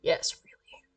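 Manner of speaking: barely voiced, very soft whisper; whispered aside. Reader confirms the game's verdict, whispered aside. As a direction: drop to a hushed whisper.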